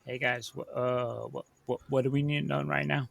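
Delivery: very low, hushed party huddle; quiet aside. Acted. Character sneaks over to confer with the party, really quietly. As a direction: speak low and softly, keeping it among yourselves. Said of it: "like, really quietly".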